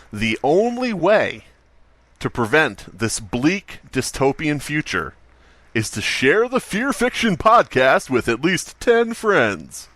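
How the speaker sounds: slow, emphatic pitch; dramatic plea. Real, not acted. Host urging listeners to share podcast, slow and dramatic. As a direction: lean in and stretch the words. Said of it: "It's going to sound crazy".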